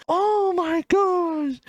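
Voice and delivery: very high, slow, breathless awe; drawn-out overwhelmed oh my gosh; stunned gasp. Retold or imitated. Cousin seeing her idol's signature, very high and slow.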